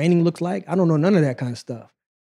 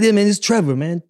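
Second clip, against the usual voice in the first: imitating Trevor's accent, higher and louder; mock accent of the coach on the phone; 6 semitones higher, 5 dB louder than usual. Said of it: "With his accent".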